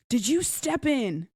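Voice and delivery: mild mock outrage; pissed. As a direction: imitate an indignant accusation, drawn out. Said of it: "he's pissed".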